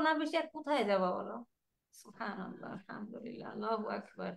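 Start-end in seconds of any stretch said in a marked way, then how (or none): 2.0-4.3 hushed devout whisper; whispered praise. Real, not acted. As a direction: murmur the praises softly to yourself. Soft devotional exclamation. (চুপিচুপি ফিসফিস করে আল্লাহর প্রশংসা)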